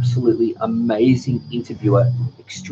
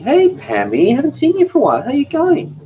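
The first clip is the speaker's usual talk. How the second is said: excited greeting; 5 dB louder than usual. Real, not acted. Greeting a viewer warmly.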